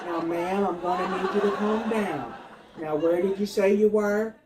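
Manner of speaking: flat official phone manner; lightly voiced emergency operator; 911 operator.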